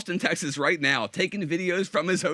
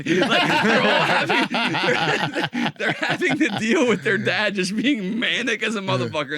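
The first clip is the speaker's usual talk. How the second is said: laughing through the words; can't stop cracking up mid-sentence; 6 semitones higher, 5 dB louder, slower than usual. Real, not acted. Friend retelling the manic dad story, laughing.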